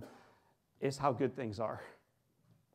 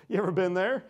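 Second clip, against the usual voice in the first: laughing tease; 5 semitones higher, 4 dB louder than usual. Real, not acted. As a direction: tease the crowd while laughing. Laughing teasing the audience.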